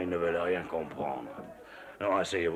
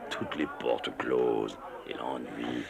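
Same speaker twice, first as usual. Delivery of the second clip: slow bitter close; 6 semitones lower, 5 dB quieter, much slower than usual. Acted. Bitter description of Djibouti.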